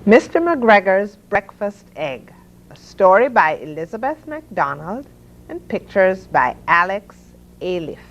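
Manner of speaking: plain reading voice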